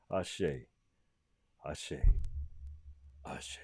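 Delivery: soft repeated word dying away; fading blessing. Real, not acted. Closing blessing for late uncle.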